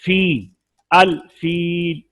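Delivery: फील with the ee held long, slowly; long ee demo. Shown as a demonstration. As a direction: stretch the ee in फील. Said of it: "जैसे अल फील में या".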